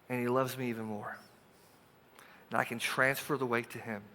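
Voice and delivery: soft, intimate, unhurried; gentle invitation. Acted. Jesus inviting worried listeners, soft and gentle. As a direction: coax them gently toward trust.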